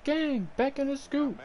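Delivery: high squeaky "Gang, back in the scoop"; squeaky catchphrase. Pretend. Streamer squeaks his catchphrase at his teammate. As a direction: pitch it way up like a silly chant.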